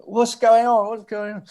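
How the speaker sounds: high-pitched teasing echo; imitated mocking mates. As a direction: squeal it in mock bewilderment. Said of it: "Like this".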